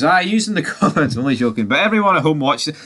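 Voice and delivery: ordinary delivery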